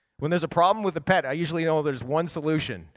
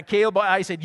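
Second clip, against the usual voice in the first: ordinary talk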